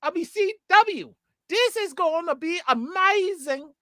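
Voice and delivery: high-pitched, put-on wrestling manager pitch; managery voice. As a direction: hype it up like a manager selling a show. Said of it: "I'm doing my ... managery voice".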